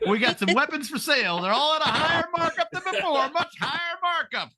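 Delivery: high-pitched mock sales pitch; arms seller pitch. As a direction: hawk it like a cheeky salesman. Acting an America selling arms.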